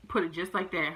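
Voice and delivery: normal talking voice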